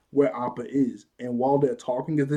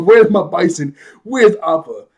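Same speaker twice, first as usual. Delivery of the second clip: loud, raised-pitch imitation of anger; hyped-up demand; 5 semitones higher, 13 dB louder than usual. Aang confronting thieves over stolen bison, hyped-up mock anger. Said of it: "he gets hyped up".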